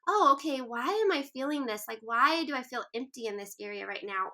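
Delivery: open, wondering self-questioning, lifted at the start; curious voice. Acted. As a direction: ask yourself with gentle interest. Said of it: "curious voice".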